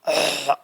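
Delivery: demonstrative throat-hawking noise; imitated spitting noise. Shown as a demonstration. Teacher showing the class how people spit, imitated hawking. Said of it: "ऐसे करके".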